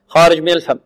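usual speaking voice

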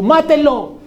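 orden aguda y fuerte, teatralizada; grito de turba. Acted. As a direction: exige la condena como uno más del gentío. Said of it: "también hubiera dicho". (clamor actuado de muchedumbre)

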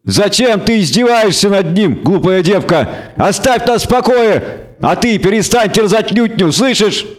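Dandelion berating the elves; agitated bard. A narrator's reading. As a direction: scold her while afraid. Dandelion pleading angrily.

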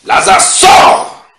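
full-force, high commanding shout; loud cry. Acted. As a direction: bellow the command, stretching each word. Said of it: "il cria d'une voix forte".

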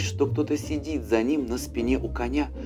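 plain reading voice

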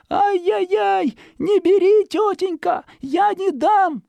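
put-on very high, loud child's wail; child crying out. A narrator's reading. Zhikharka under the stove shouting at the fox, high loud wail. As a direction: cry out like a frightened little boy. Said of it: "во весь голос".

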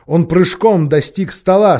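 ordinary delivery

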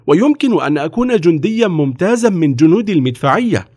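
put-on high-pitched boyish appeal; eager pleading boy. A narrator's reading. Napoleon begging the officer to enlist him, pleading. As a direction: plead your case in a high young voice.

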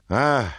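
short, high exclamation; cried-out "ah". A narrator's reading. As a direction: let out a quick high "ah!". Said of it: "l'arrotino gridò".

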